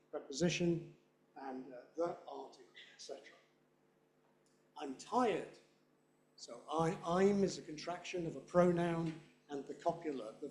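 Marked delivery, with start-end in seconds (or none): 4.8-5.6 unstressed "I'm", full stress on "tired". Shown as a demonstration. Teacher showing pronouns go unstressed, stress on tired. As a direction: slide over "I'm" and land on "tired". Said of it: "Neither of those are usually stressed".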